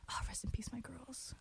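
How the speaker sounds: whispering. Acted. Mourning disbanded group Luna.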